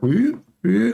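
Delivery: high, swooping "wee, wee"; two high-pitched sliding whees; imitated swaying noise. Shown as a demonstration. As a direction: mimic something swinging back and forth. Imitates the earth swaying side to side.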